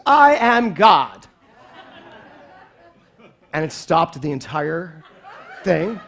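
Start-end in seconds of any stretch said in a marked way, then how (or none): 0.1-1.3 slow, loud and sure; a child announcing I am God, loud and slow; boy's proud declaration. Retold or imitated. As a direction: declare it like a bold little boy. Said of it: "he goes".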